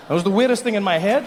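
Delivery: normal talking voice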